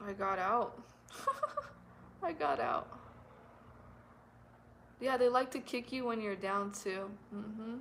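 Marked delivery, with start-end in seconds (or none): 0.1-2.9 real relieved laugh at escaping. Real, not acted.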